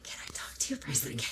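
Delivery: breathy whisper, fast and hushed. Retold or imitated. Interrupting a man's push-ups with a hushed whispered request. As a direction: sneak the question in a quick whisper. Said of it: "now I'm whispering".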